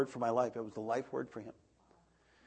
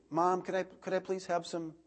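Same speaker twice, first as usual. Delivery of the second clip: timid begging servant; 7 semitones higher than usual. Retold or imitated. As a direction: beg hesitantly for food. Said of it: "a servant would come in and go".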